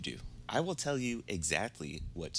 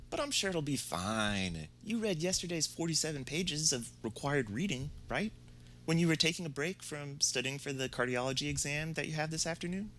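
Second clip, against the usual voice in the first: high, lightly voiced teasing prof; mock sweet professor; 8 semitones higher than usual. Professor teasing students about the reading, high mock sweetness. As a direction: needle the students with fake reassurance.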